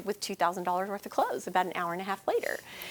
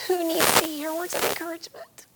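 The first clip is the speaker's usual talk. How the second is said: choked whisper; 5 semitones higher than usual. Real, not acted. Tearful speaker whispering through tears.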